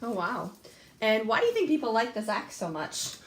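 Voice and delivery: normal talking voice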